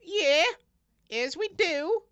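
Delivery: high, slow squeaky rabbit indignation; defensive rabbit voice. Acted. Rabbit justifying the attack on the farmer. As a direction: plead the rabbits' case.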